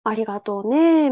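slow, drawn-out imitation of an old man; lightly voiced elderly shopkeeper; old man's rhythm. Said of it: "もうこ-、こんなリズムで".

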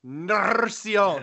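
Encarnacion slow and high, rolled R. Shown as a demonstration. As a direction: trill the R in Encarnacion slowly. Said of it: "Can you roll the R".

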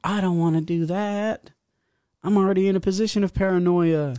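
high-pitched mock protest; retold reluctance. Drunk tourist refusing to smoke, high mock protest. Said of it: "And I'm like".

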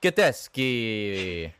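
Gatteschi drawn out slowly in a mock Italian accent. Co-host asked for the accent, Gatteschi in exaggerated Italian. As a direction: ham up Gatteschi with an Italian lilt, stretched long. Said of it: "Say it with the accent".

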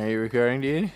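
normal talking voice

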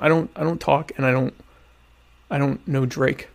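usual speaking voice

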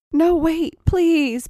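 high, loud, urgent plea; begging. A narrator's reading. Tracy stops Molly putting the key away, urgent begging. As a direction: cry out to stop them, begging. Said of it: "begged Tracy".

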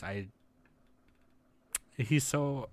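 ordinary talk

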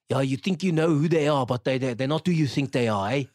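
imitated South African villain's accent. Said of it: "When he was there talking".

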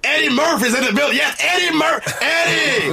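high, drawn-out talk-show announcer shout; Arsenio Hall. Pretend. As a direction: announce the guest with a whooping high call. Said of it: "Uh, Arsenio Hall".

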